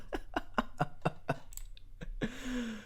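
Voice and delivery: short airy giggle, high and falling; breathy giggle. Real, not acted. Amused by the fallen Khajiit, a soft high giggle. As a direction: let a quick unvoiced laugh slip out. Laughing at cat tails on the battlefield.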